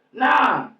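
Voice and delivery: sharp loud dismissal; shouted nah. Real, not acted. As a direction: throw it away with a shout.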